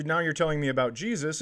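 ordinary talk

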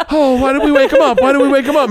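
high, loud laughing whine; panicky little brain. Retold or imitated. Little brain dreading big brain waking, high loud whine. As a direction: wail that the big one woke up. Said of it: "little brain's like".

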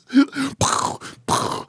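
a high breathless laugh at his own yarn; high airy laugh. Real, not acted. Footy player laughing at his own tall tale, high airy laugh.

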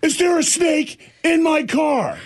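high, slow alarmed question; shrill-free but high panicked demand; mock mom outburst. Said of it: "Like Hulk just, bam".

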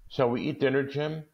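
wife coaxing husband to dinner; Della coaxing. Acted. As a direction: lift it hopefully toward him. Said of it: "she said".

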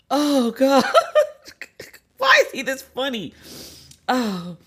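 high-pitched laughing exclamation; cracking up while talking; helpless laughter. Real, not acted. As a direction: say it through real, uncontrollable laughter. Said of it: "I can't stop laughing".

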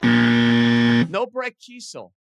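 low, loud and very slow denial; deep game-show no. Acted. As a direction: drag it out like a buzzer rejection. Rejects own guess again.

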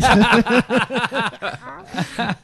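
high, loud, laughing through it. Retold or imitated. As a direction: laugh as you ask. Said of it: "laughing retold quote".

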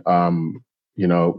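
ordinary talk

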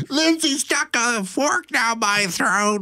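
put-on high, drawn-out child's complaint; whining tattling kid. Dad mimicking his fighting daughters, high whiny tattle.

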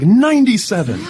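high, laughing call of the price; excited call. Retold or imitated. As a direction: call out the number in disbelief through a laugh. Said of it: "it's like".

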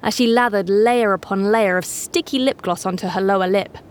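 plain reading voice

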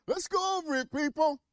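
high, loud call to the audience; rallying call. Real, not acted. Host calling out to viewers, rallying them loudly. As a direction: whip the crowd up to go over it.

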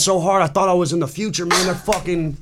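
high, half-laughing imitation of bewilderment; mock tripping freak-out. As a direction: play a stranger reeling from a bad shock. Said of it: "he's freaking out".

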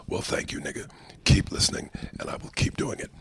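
hushed, whispery comedian impression; Paul Mooney. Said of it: "Your random Paul Mooney impressions".

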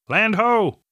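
high, ringing call of land sighted; shouting from crow's nest. A narrator's reading. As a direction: call it out from the masthead. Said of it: "the lookout called from the crow's nest".

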